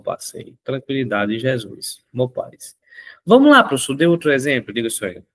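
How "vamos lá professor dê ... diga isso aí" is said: a student's excited shout, then settling; eager student. Acted. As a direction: urge the teacher to give another one. Student voice urges teacher on.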